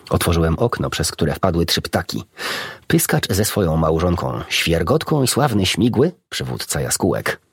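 ordinary delivery, nothing marked

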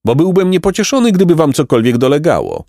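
put-on high, bright-hearted old peasant voice; cheerful peasant. A narrator's reading. Peasant reassures the two travelling boys, high and kindly.

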